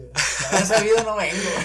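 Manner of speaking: laughing loudly through the words. Real, not acted. Friend recalls being kicked out, laughing loud. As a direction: blurt it out with a big laugh. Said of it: "laughing regret".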